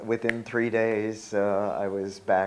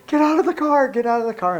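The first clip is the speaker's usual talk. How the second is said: urgent shout; 9 dB louder, faster than usual. Retold or imitated. Friend runs out of bank shouting.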